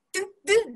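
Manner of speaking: "ドゥッドゥッ" as short quick choked bursts; throat-stopped d. Shown as a demonstration. Teacher showing the common mistake, "ドゥッドゥッ" short and blocked. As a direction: cut the breath in the throat on "ドゥッドゥッ". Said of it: "喉で息を止めてしまいがち".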